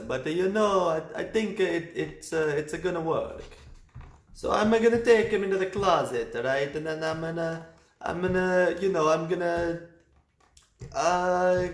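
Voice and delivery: fumbling, nervous priest scheming aloud; hammed-up priest plotting. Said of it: "fucking priests being like".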